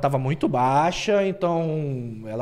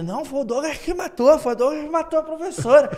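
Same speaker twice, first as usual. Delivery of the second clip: joking; 10 semitones higher than usual. Retold or imitated. Classmates joking about the death.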